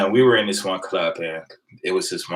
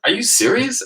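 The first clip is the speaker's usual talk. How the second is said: incredulous. Retold or imitated. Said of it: "I said".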